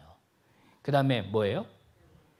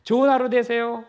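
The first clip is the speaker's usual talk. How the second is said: high, louder send-off; cheery farewell; 8 semitones higher, 5 dB louder than usual. Shown as a demonstration. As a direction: wish a neighbour well as they leave. Demo of cheery parting wish.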